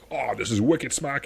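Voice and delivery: put-on broad, drawn-out South Boston accent. Podcaster imagining Patrick Henry on brainy Madison, South Boston accent. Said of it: "a super South Boston accent".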